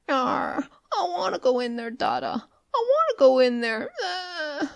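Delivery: put-on high whining little boy, crying to his father; a spoilt child wailing at the door; squeaking crying child. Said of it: "a squeaking little voice could be faintly heard".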